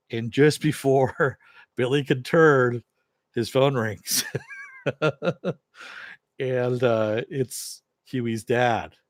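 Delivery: loud, amused telling through laughter; laughing. Real, not acted. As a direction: break into laughter while telling it. Recapper cracks up telling the scene.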